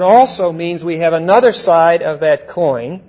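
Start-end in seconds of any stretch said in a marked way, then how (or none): 0.0-0.5 also said clearly louder; stressed word. Shown as a demonstration. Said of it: "Will you note the word".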